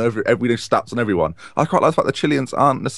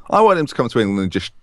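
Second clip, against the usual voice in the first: ordinary talk, nothing marked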